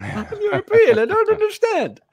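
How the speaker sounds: high squeaky mock confusion; mock clueless European. Playing dumb European abroad.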